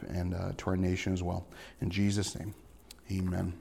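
soft, hushed pastoral prayer. Real, not acted. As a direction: pray quietly and sincerely to God. Leads a prayer.

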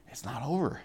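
hushed assurance. Shown as a demonstration. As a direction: gently counter with a quiet "It's not over". Answers it with eternity.

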